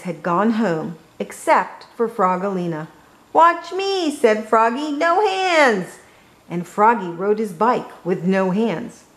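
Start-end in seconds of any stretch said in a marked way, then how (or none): 3.3-4.1 high, excited call; showing off. A narrator's reading. Froggy showing off to Frogalina, high excited call. 4.9-6.0 high, excited call; showing off. A narrator's reading. Froggy showing off to Frogalina, high excited call.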